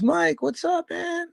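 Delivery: high excited greeting, hammed-up; imitating Chip. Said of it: "And he goes".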